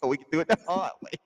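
hammed-up tough-guy warning; prison threat. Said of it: "That's what that shit sound like".

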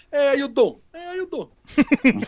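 high, slow Queens Italian-American greeting; New York Italian accent. Retold or imitated. Greeting neighbours in Astoria in a New York Italian accent. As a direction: stretch out "how you doing" in a New York accent. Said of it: "if I do the accent".

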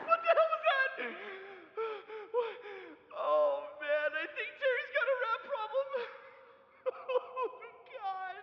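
high, loud, half-laughing terror; terrified pleading. Acted. Stan begging Carl to free him from the rat cellar, high terrified pleading. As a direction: beg for your life like a scared kid.

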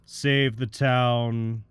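loud, slow villain voice; Slade voice. Acted. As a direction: boom it out as the masked villain. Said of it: "Very impressive Slade voice".

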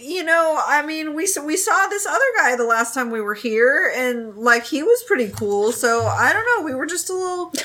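high, apologetic mock voice; mock-polite youth apologising to a grumpy villager; sheepish apology. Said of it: "Lan Xishui's like".

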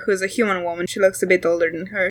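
ordinary talk, nothing marked

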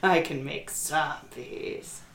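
low, slow imitation of demon voice; deepened mimicry. Player mimicking game master's dramatic demon, deep and slow. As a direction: drop deep and draw it out. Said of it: "the deeper your voice got".

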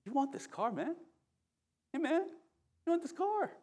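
high, soft voice in the head; sneaky, high-pitched mock temptation; tempting inner voice. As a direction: coax softly in a high little voice. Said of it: "there's a voice in my head".

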